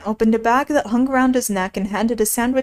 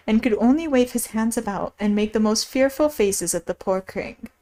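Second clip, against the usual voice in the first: ordinary delivery, nothing marked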